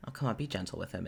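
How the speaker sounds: quick, low playful teasing. Acted. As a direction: toss it off lightly and fast. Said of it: "you hear a very playful from [name]".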